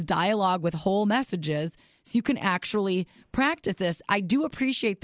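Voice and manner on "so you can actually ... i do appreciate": ordinary talk, nothing marked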